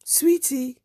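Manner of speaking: calling out. Retold or imitated. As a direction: call her name from behind. Woman calling from behind.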